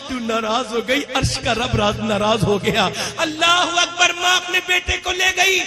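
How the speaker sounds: usual speaking voice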